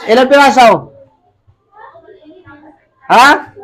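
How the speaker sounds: normal talking voice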